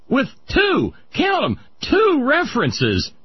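emphatic shout. Real, not acted. Host stressing the damning count.

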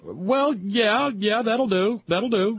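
high, delighted that'll do; impressed. Real, not acted. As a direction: sound bowled over and pleased. Impressed by Obama note.

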